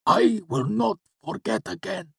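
very high, strained promise; earnest gasp. A narrator's reading. Cinder promising his lord obedience, gasping earnestly. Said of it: "he gasped out earnestly".

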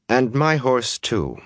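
firm. A narrator's reading. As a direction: add the demand firmly. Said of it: "Fail said firmly".

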